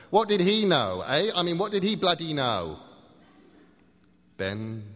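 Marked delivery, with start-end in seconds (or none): 0.1-2.8 angry rant. Acted. Ranting about Lovecraft.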